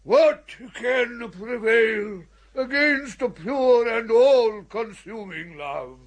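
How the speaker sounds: normal talking voice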